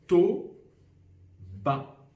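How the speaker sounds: To-ba with a loud to, long pause, then ba; reversed syllables, separated. Shown as a demonstration. Teacher demonstrates inverting syllables, "To-ba" slowly split. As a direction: punch "To", wait, then place "ba" apart. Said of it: "Si j'inverse".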